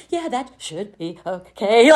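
slow, deliberately casual reply; acting cool. Answering a big salary offer, slow forced casual calm. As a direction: fake nonchalance, holding back glee. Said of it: "I had to try to act cool".

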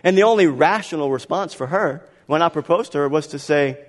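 normal talking voice

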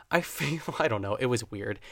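ordinary talk, nothing marked

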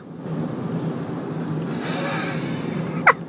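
wheezy laugh. Real, not acted. Amused by his brown hair comparison, wheezing laughter. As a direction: break up laughing at your own joke.

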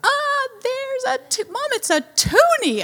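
very high, drawn-out squeal of delight; hammed-up gasping little-boy joy; elated child imitation. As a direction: mimic a thrilled kid's discovery. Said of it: "He is elated".

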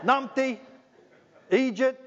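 high, loud Scottish jeer of numpty; mock driver insult. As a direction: yell it like an annoyed Scottish driver. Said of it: "I hear people call me that as I drive".

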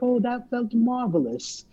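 high, slow, airy satisfaction; lightly voiced breezy calm, savouring each word; a hint of a serene, pleased partner; calm and cool. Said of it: "she's just calm and cool".

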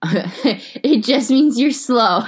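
slow, laughing punchline. Real, not acted. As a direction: drag it out through a laugh. Joke punchline said slowly.

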